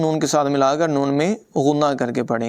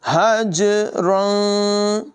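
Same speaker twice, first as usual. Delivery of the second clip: slow, higher melodic tajweed chant; Quran recitation; 6 semitones higher, much slower than usual. Shown as a demonstration. Teacher recites the verse for students, slow melodic chant. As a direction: chant each word long and flowing.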